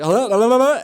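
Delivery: high, loud repeated hellos; frantic calling out. Retold or imitated. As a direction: call urgently into a dead line. Acting out accidental Bluetooth connection, calling out.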